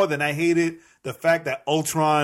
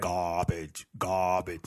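low, soft New York "Garbage"; trailing New York "garbage" said low; New York accent; 8 semitones lower, 8 dB quieter, much slower than usual. Shown as a demonstration. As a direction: say "Garbage" like a New Yorker, quietly. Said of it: "purposely saying it with the New York accent".

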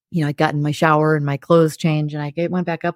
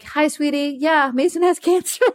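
bright, much higher, forced cheery greeting; 9 semitones higher than usual. Retold or imitated. Mother telling son's friend he has cancer, forced cheer. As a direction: sound sweet while saying the awful thing. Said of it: "I was like".

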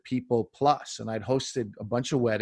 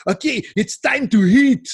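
put-on loud, high French-accented TV cook; hammed-up French-Canadian host announcing dinner; exaggerated Quebec French accent, called out brightly; thick French accent; 11 semitones higher, 9 dB louder than usual. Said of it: "the French guy's like".

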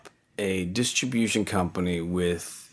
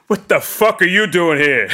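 much higher, louder mock outburst, laughing through it; mock angry boss; 10 semitones higher, 10 dB louder than usual. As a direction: act out the boss catching him, half-laughing. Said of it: "and he goes".

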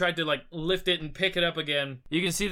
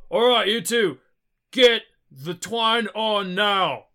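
loud, high, slow command; straining order; 8 semitones higher, 9 dB louder, slower than usual. Acted. Lifter orders two helpers to tie twine, straining shout. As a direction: call it out while holding up a heavy load.

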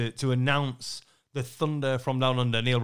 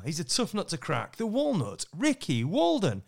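announcer read rising on the Walnut, slowing on the name; MC intro again; 5 semitones higher than usual. Retold or imitated. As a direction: give the name the big arena build. Retells MC intro in arena.

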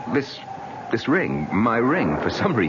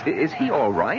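ordinary talk

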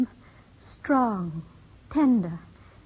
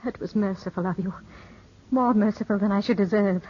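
normal talking voice

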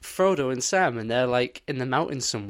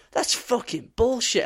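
higher, mock outraged; retold indignation; 10 semitones higher than usual. Retelling finding half a movie, high mock outrage. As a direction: act out being fed up. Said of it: "I was so pissed off".

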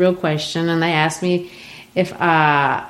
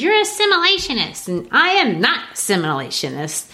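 mock-accuse her of giving in, then settle; Asagai accusing; 6 semitones higher than usual. Asagai teasing Beneatha over her assimilation, high playful voice. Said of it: "And he says".

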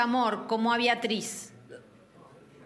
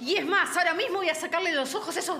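furious calling out; 5 semitones higher than usual. Acted. The betrayed woman yelling at her boyfriend and his lover, enraged. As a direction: call the cheating pair over, raging. Refuses to be hushed, calls couple over.

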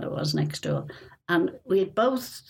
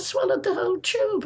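high, incredulous retold question; surprised surgeon; 12 semitones higher than usual. Surgeon on the phone, repeating the nurse's news in disbelief. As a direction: echo the news in disbelief, pitched up. Surgeon hears patient swallowed tube.

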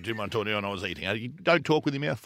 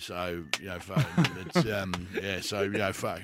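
mumbling Swanee impression; 8 semitones lower, 4 dB quieter than usual. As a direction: mumble it like you couldn't care less. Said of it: "question for you, Swanee".

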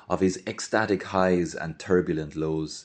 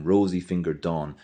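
usual speaking voice

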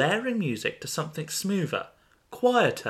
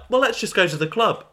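casual suggestion; 7 semitones higher, 5 dB louder than usual. Retold or imitated. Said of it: "they were like".

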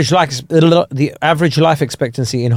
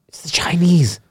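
hushed mock-panic whisper; 5 dB quieter than usual. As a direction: breathe it as a secret warning. Panicked retold official, secret warning.

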